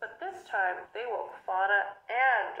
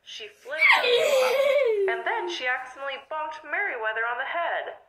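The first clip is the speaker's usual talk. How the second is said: ordinary delivery, nothing marked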